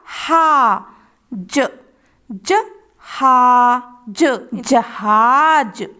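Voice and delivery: ताला drawn out very slowly; slow reading demo for children; slow blending. Shown as a demonstration. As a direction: read ताला slowly syllable by syllable. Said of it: "तीन अक्षर वाले शब्द".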